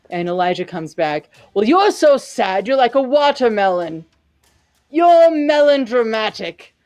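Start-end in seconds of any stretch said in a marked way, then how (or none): 1.5-6.7 mocking insult, louder and higher toward the end; taunting. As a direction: rub it in with relish. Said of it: "Elijah taunting Miran".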